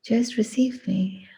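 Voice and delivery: ordinary delivery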